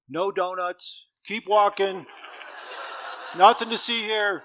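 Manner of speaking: high, loud, laughing bouncer voice; a guardian angel shooing him off, loud and high; mock angel guard. Angel at the door waving him on, big and laughing.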